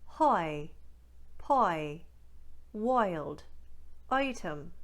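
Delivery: Newfoundland "wild", "despise", "kite" with an oi sound; Newfoundland accent, aw-I glide. Shown as a demonstration. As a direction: drill I words in a Newfoundland accent. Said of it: "Let's try some more".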